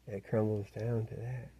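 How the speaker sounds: ordinary delivery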